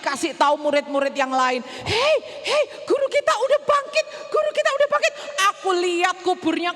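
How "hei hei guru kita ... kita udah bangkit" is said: high excited shout to friends; excited news. Acted. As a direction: burst in with the good news. Running to tell disciples.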